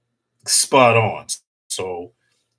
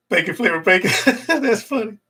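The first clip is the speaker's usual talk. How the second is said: usual speaking voice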